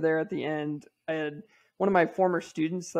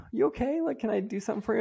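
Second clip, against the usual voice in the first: higher, caring wife voice; imitating wife's gentle concern; 6 semitones higher, faster than usual. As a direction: imitate the worried wife checking on him. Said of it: "my wife was like".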